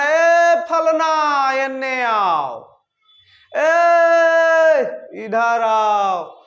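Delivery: long drawn-out far call; calling from afar. Shown as a demonstration. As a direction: stretch the vowels as if calling someone far away. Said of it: "दूर से किसी को बुलाना". (दूर से पुकारना, स्वर लंबा खींचकर)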